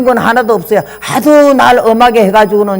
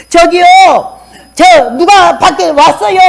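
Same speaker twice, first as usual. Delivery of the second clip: higher, louder and slow call; 8 semitones higher, 4 dB louder, slower than usual. Retold or imitated. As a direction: call him from across the house. Yelling to husband someone came. (남편을 크게 부르는 소리)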